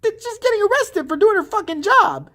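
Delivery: high-pitched indignant squeal; mock upset. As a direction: wail it in exaggerated outrage. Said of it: "she's just, like, all upset".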